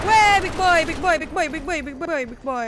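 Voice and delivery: loud high panicked calls at the monster; panicked yelling. Real, not acted. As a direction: yell it out in a panic.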